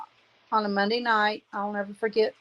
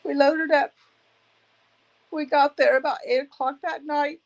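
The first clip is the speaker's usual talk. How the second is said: higher, louder, voice cracking with grief; voice breaking; 6 semitones higher, 3 dB louder than usual. Real, not acted. Widow recalling the drive to her dying husband, voice breaking. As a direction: fight back tears as the words catch. Retelling husband's final hospital trip.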